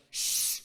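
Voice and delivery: loud, insistent shush; retold forceful shushing. Student turns on girls still talking during the sermon, loud shushing. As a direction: hush them hard, acting it out. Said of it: "He turned around and went".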